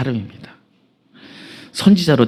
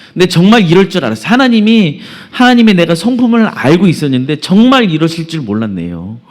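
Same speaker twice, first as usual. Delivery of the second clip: higher-pitched, exasperated outburst; acting furious; 5 semitones higher than usual. Jonah protests to God about sparing Nineveh, acted anger. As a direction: protest indignantly, voice riding high. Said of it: "화가 끝까지 났습니다".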